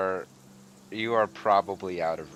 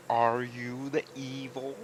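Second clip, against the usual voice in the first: slow, slightly higher ancient tree voice; talking tree; 4 semitones higher, slower than usual. Acted. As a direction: speak slowly like a towering old tree. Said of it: "The tree creaks down like it's looking down".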